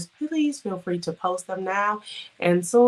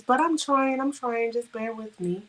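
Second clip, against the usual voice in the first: ordinary talk, nothing marked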